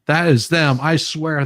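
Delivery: pointing it out, thrilled to show her; excited. Real, not acted. Showing Mary the video.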